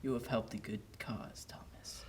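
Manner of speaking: soft whispered blessing; ghost whisper. Acted. Ghost releasing Thomas, soft and whispered. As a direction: whisper it like a spirit.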